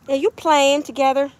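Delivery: normal talking voice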